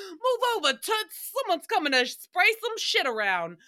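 high, loud laughing shout; shrieking sassy command. Retold or imitated. Flier barging into first class, high laughing shout. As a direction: yell it through laughter. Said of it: "I'm like".

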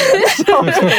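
a high laugh falling away; amused laugh. Real, not acted. Host laughs that adaptations lag years behind, amused laugh. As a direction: laugh at how far behind it is.

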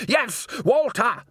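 high, slow, exasperated confirmation; emphatic insistence. A narrator's reading. Salzella overruling the doubting man, emphatic. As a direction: insist on it, each word drawn out high.

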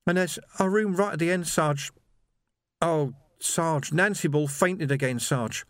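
put-on high, halting, upset young man; distressed young constable. A narrator's reading. Shaken young Sam tells his sergeant of the cells, distressed.

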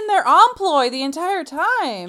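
loud, high protest, drawn out; exasperated, emphatic outburst; heated insistence. Real, not acted. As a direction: argue it at full volume, pitch way up. Heated mock argument over a character.

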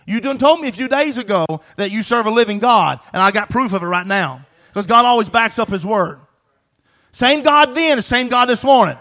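loud, high, shouted preaching; full-force preaching. Acted. Pastor urging the congregation, shouting at full force. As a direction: drive it home like a fired-up pastor.

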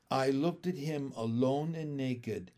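usual speaking voice

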